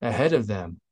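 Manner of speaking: plain reading voice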